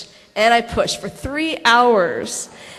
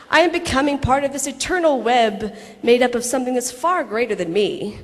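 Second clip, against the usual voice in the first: ordinary talk, nothing marked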